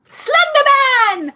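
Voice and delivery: very high, loud, slow shriek; shocked yell. Real, not acted. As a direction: scream the name in mock terror. Joking exclamation at picture.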